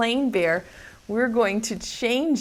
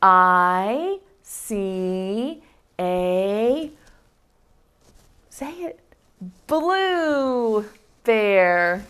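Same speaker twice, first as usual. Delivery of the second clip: slow reading; 2 semitones lower, much slower than usual. Shown as a demonstration. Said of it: "like this".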